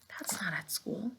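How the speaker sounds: a thinking-aloud whisper. Shown as a demonstration. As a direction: whisper your reasoning to yourself.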